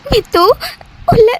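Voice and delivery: halting, tearful attempt to explain; acted crying. Weeping friend revealing who the new MD is, crying. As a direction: sob between the words. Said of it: "எதுக்குடி இப்படி அழுதுட்டு இருக்க".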